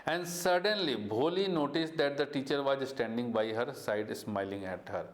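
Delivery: slow, deliberate read-aloud; storybook narration for students; story reading. A narrator's reading. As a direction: read the textbook line slowly to the class. Teacher reads story text aloud.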